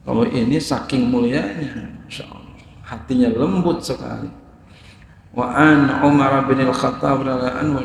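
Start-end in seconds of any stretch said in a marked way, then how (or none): 2.0-2.6 a hushed whisper of wonder; awed whisper. Real, not acted. Preacher to congregation, hushed awed whisper. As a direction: breathe it out, voiceless, in awe. Awe at the noble heart.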